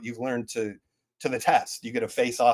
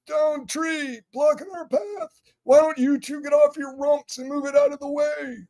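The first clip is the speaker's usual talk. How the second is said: very high, pinched cartoon voice; gruff game character. Dev replaying a game character ordering two heroes, high cartoon voice. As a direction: bark orders in a squeaky cartoon voice. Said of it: "So I'm that dude".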